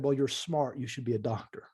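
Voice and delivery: normal talking voice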